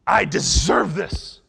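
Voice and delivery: loud, high mock tantrum; demanding kid. Kid demanding an iPad, entitled mock tantrum. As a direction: insist like a child stamping out each demand. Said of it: "when the kid says".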